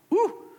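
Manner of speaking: sharp high whoop of shock; surprised whoop. Acted. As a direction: let out a quick high woo. Reacting to a throat grab.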